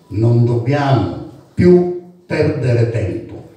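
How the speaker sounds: impassioned declaimed poem, building through the lists; fiery spoken-word delivery with measured pauses; protest poem recital. Acted. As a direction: declaim it like a public indictment, line by line. Recites own protest poem after title.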